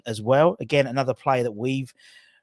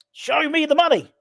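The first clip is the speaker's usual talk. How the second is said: film catchphrase; 5 dB louder than usual. Pretend. Quotes the famous film line.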